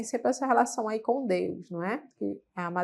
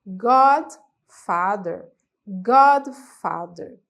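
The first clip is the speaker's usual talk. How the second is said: slow, loud model word; 6 dB louder, much slower than usual. Shown as a demonstration. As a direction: stretch out Godfather slowly and loudly. (palavra Godfather dita devagar e forte)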